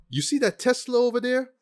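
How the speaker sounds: high, squeaky quote of the hacker line; mock movie line. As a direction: toss off the silly line in a high voice.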